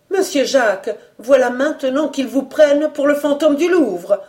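high, worked-up announcement; agitated blurting. A narrator's reading. Breathless cook breaking bad news, agitated. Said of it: "Et tout d'un trait, elle lâcha".

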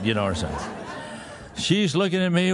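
ordinary talk, nothing marked

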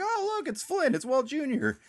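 very high-pitched excited squeal of recognition; vocal excitement. Retold or imitated. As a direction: cry out in a hammed-up, high excited voice. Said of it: "that's where I was vocal".

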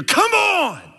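very high, loud drawn-out yell; dad's scream. Retold or imitated. Father yelling at his kicker son from the stands, screaming. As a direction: holler it like an exasperated sports dad. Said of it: "His dad's voice would scream like".

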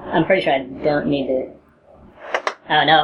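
ordinary talk, nothing marked

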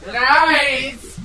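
high, loud villain shout; theatrical outburst. Acted. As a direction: spit the word out in outrage.

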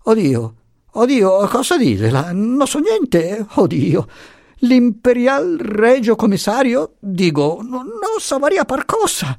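put-on high, frightened old-man whine; whimpering. A narrator's reading. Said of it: "si mise a gemere".

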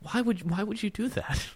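pained, quiet disbelief, retold; retold exasperation. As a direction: imitate an aggrieved whine lightly. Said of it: "and I'm just like".

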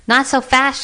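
high-pitched frightened shout. A narrator's reading. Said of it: "shouted somebody".

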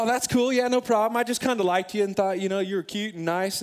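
forced casual. Retold or imitated. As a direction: act like it doesn't hurt at all. Faking nonchalance after rejection.

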